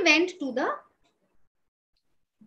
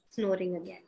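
ordinary delivery

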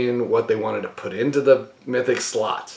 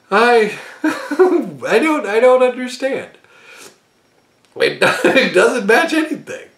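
laughing; 7 semitones higher than usual. Real, not acted. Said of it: "I started laughing".

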